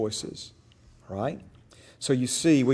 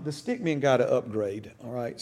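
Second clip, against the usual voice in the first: normal talking voice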